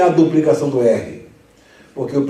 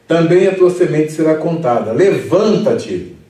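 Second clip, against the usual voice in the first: scripture reading; 3 semitones higher, 5 dB louder than usual. Acted.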